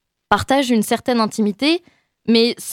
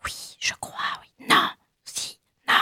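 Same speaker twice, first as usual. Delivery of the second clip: unvoiced whisper, much softer; whispered asides; 8 dB quieter than usual. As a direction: whisper back and forth like a conscience. Whispered inner voices debating.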